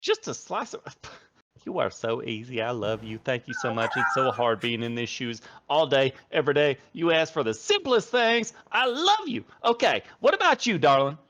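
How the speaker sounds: high, doting tavern waitress, fussing over customers; gushing barmaid. Acted. Barmaid Boo Boo takes the party's orders, high and gushing. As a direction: coo over every order like a smitten waitress.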